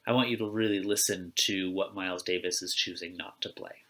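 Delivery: ordinary talk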